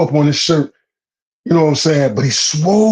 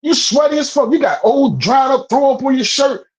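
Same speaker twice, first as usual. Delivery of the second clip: pitched way up, half laughing in disbelief; can't believe how ripped he was; incredulous excitement; 10 semitones higher than usual. Real, not acted. To the friend, about the swole homeless man: high incredulous rant.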